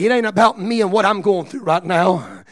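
loud, high, rising declaration of faith; praise declaration. Retold or imitated. Preacher voicing a resolute believer, rising and loud. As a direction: proclaim it with growing fire. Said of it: "is when you say".

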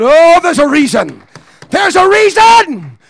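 high, loud shouting exhortation; shouted preaching. Acted. Preacher urging the church not to quit, shouting high and loud.

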